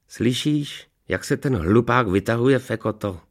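talking hound. A narrator's reading. Hound to his colleague about the boasting master, snide aside. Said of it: "prohodil psí řečí chrt Fekota".

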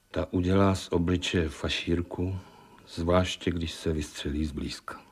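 hushed, subdued confession, falling away; chillingly quiet. Acted. Husband describes what the shotgun does, chillingly quiet. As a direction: let it drop softly into menace.

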